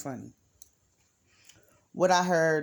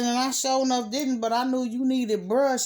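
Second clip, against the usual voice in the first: excited retelling; 8 semitones higher, 6 dB louder than usual. Retold or imitated. Excitedly retells her answer.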